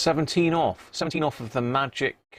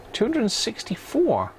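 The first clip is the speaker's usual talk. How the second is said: excited; 10 semitones higher than usual. Real, not acted. Repeats the surprising reading.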